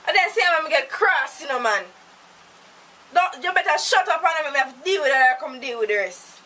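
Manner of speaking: loud, heated scolding rant; angry tirade. Acted. Woman raging at the captive who mocks her, loud. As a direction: tear into him at full volume.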